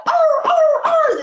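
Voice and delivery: high loud yelping dog cries; dog yelps. Acted. Dog yelping as the stick hits him, high and loud. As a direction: yelp like a dog hit on the tail.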